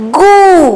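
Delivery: गू with a long, held "oo"; syllable demo. Shown as a demonstration. Teacher adding the vowel sign to ग, गू drawn out. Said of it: "ग पर लगाएंगे तो".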